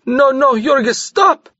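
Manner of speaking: high, frantic plea to stop; panting wildly. A narrator's reading. Maria holding Jorgis back from the ladder, frantic. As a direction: grab him and beg him to stop. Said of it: "panting wildly".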